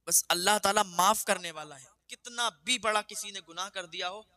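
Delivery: preacher exhorting listeners to sincere repentance, fervent; fervent preaching. Acted. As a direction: urge the listeners never to lose hope. Preaches to audience.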